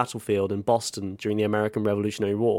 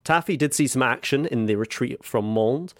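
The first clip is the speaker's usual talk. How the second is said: usual speaking voice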